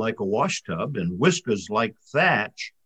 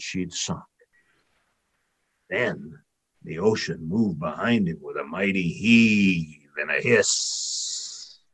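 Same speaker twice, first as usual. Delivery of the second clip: plain reading voice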